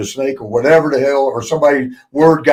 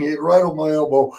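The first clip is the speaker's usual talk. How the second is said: ordinary talk, nothing marked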